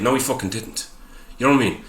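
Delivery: ordinary talk, nothing marked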